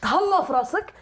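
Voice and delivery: put-on high, loud Moroccan accent; threatening Moroccan accent. Comedian shows how Moroccans talk, threatening Moroccan accent. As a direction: sound ready to hit someone, in Moroccan. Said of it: "on dirait qu'ils vont te frapper".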